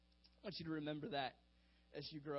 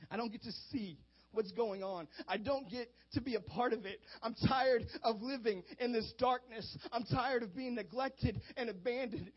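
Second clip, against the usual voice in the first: higher, louder, pleading voice rising in anguish; blind man's lament; 5 semitones higher, 7 dB louder than usual. Retold or imitated. Blind beggar tells Jesus of being left behind, anguished plea. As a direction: pour out the ache of being left out. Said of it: "He says".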